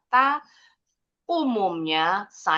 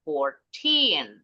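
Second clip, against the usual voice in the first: put-on FOURTEEN with a long, higher-pitched TEEN; 2 semitones lower than usual. Shown as a demonstration. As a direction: stress the second syllable of FOURTEEN. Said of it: "tinnya lebih tinggi intonasinya". (FOURTEEN, intonasi "teen" lebih tinggi)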